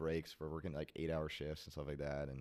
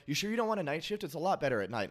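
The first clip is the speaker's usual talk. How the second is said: pushy boss voice; 10 semitones higher, 7 dB louder than usual. Retold or imitated. Manager pushing night shift.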